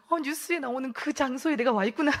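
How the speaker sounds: high, loud mock alarm; amused retelling of a scared realization; frightened realization. Newcomer realizing she is in a strange place, mock alarm. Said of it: "너무 무서워서".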